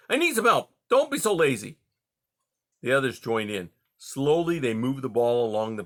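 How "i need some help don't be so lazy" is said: high, loud scolding cub voice; heated. Acted. Fox cub scolds his siblings, heated and impatient. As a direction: scold them for being lazy. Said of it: "he called hotly".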